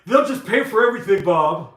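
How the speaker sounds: loud, high mocking voice of people; mimicking what people tell him, loud and high; mocking quote. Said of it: "people like".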